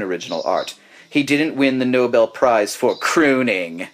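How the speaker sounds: posh British critic reading out a review; mock-serious British reviewer's voice; British accent. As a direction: put on a plummy British accent. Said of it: "I'm gonna do it in a British".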